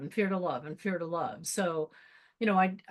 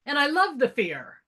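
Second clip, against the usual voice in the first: ordinary delivery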